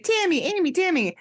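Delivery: put-on high-pitched child chanting names; playful high kid voice; imitated young daughter. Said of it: "my daughter loves Thousand Pound Sisters. So she's like".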